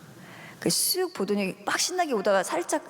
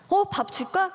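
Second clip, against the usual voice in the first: put-on much higher, sing-song offer to a child; sweet high coax; 11 semitones higher, slower than usual. Mother to her daughter offering food, sweet high coax. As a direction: lift the voice sweetly like coaxing a toddler.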